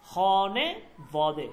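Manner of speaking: খনে বদে put back together, said very slowly; slow rejoined demo. Shown as a demonstration. Teacher recombines the phrase for the class, slowly. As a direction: join খনে বদে again, unhurried and clear. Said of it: "শব্দটা হচ্ছে এইভাবে".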